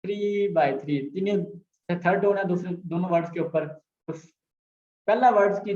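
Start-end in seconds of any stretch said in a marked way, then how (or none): 0.0-1.1 slow example. Shown as a demonstration. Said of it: "For example".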